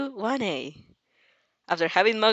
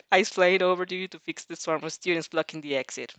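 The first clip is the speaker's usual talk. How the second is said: usual speaking voice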